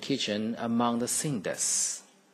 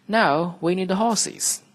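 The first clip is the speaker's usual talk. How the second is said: fairy godmother; 8 semitones higher, 7 dB louder, slower than usual. Acted. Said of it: "said the fairy godmother".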